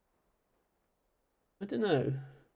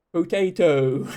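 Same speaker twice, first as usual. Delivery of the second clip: American accent; 7 dB louder, slower than usual. Shown as a demonstration. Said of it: "we don't say potato".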